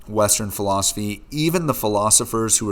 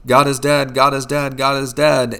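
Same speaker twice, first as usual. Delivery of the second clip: "God is dead" repeated, higher and louder; retold hysterical yelling; 5 semitones higher, 6 dB louder than usual. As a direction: cry out like a frantic madman in a tavern. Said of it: "he starts yelling".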